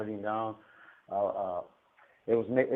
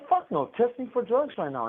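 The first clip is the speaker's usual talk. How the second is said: indignant retort; 11 semitones higher, 4 dB louder than usual. Retold or imitated. Retells his indignant reply to staff.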